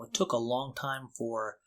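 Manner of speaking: ordinary talk, nothing marked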